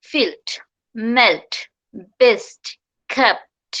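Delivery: ফিল্ড, মেল্ট, বেস্ট, কেপ্ট with a crisp released final T; word by word, pop each final T in মেল্ট; true T. Shown as a demonstration. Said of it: "এগুলো সবগুলো হচ্ছে ট্রুটি".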